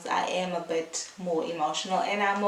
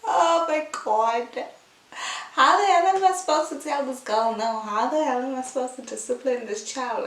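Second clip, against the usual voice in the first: high, loud, fast rush of mock despair; exasperated adoring outburst; 5 semitones higher, 6 dB louder than usual. Mom to herself about her sweet baby, high loud mock despair. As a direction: throw up your hands at how cute she is. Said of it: "and I'm just like".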